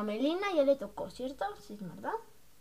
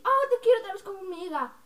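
high, louder mock gushing; Daniela; 7 semitones higher, 5 dB louder than usual. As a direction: mimic a fickle friend, pitched up. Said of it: "luego vuelves a decir".

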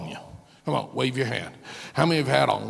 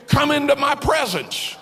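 higher, louder, ringing preacher voice; full-force preaching; 10 semitones higher, 4 dB louder than usual. Acted. Pastor preaching God's promise to the congregation at full force. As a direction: lift the church with the promise.

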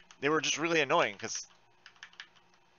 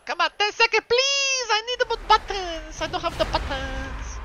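much higher pitched, pleading whine; desperate pleading; 3 dB louder than usual. Real, not acted. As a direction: beg the game for your buttons. Waiting on cooldowns, desperate.

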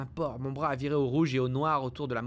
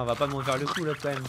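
ordinary delivery

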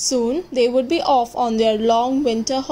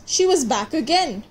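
ordinary delivery, nothing marked

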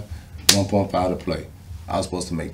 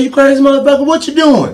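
much higher, loud, excited caller voice; Rob Ryan on the phone; 11 dB louder than usual. Retold or imitated. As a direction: play the coach yelling happily down the line. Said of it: "He said".

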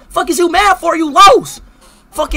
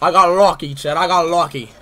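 a much lower, sneering echo of his taunt; mocking the loser; 13 semitones lower, 6 dB quieter than usual. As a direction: throw his line back at him, lower. Keeps mocking the opponent.

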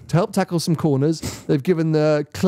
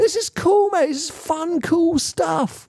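excited; 11 semitones higher, slower than usual. Real, not acted. Excited about shift feature.

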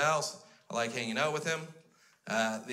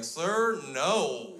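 raised, loud voice of disbelief; louder, higher telling-off; indignant doorman; 5 semitones higher, 4 dB louder than usual. Acted. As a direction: scold like an appalled bouncer. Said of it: "the gentleman at the door is like".

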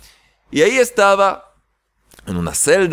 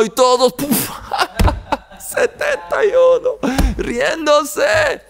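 entre carcajadas, voz mucho más aguda; riendo; 11 semitones higher, slower than usual. Retold or imitated. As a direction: imitando la risa de todos los presos, muy agudo. Imita risas de los presos. (riéndose a gritos, imitando a los presos)

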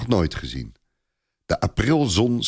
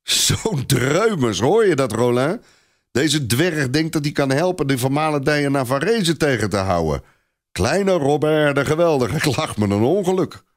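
put-on higher, amused jeering at the little boy; laughing mockery; 6 semitones higher than usual. A narrator's reading. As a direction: scoff at the dwarf's big dreams. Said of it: "Clopin begint hard te lachen".

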